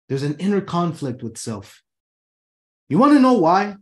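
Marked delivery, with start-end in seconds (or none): 2.9-3.8 emphatic hook. Real, not acted. Rhetorical hook to audience.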